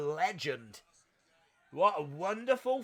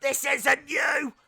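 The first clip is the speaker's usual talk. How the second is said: pleading wrestler; 7 semitones higher, 4 dB louder, slower than usual. Retold or imitated. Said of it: "Marty Bell's like".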